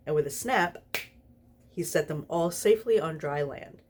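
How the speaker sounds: ordinary delivery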